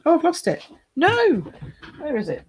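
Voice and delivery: a playful wail of alarm; mock panic. Real, not acted. Crafter losing a card on stream, mock panic.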